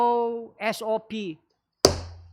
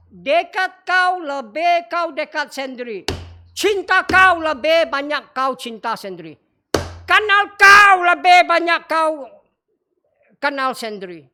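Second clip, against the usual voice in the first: higher and louder with each phrase; building rhetorical crescendo; 4 semitones higher, 8 dB louder than usual. Real, not acted. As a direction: climb toward the climax. Preacher building rhetorical triad. (khotbah memuncak semakin lantang)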